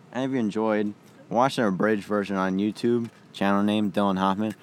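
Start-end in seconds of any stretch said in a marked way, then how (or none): 1.7-4.5 loud announcer plug; podcast outro. Acted. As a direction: sign off like a host.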